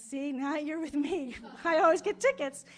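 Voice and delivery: laughing I-told-you-so; laughing tease. Retold or imitated. Boyfriend teasing at police stop.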